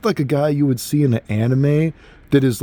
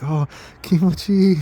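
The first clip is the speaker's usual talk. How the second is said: high mock moan; 7 semitones higher than usual.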